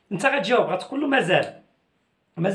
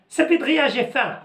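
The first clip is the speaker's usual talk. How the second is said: mock calling out; 6 semitones higher than usual. Acted hungry husband calling wife.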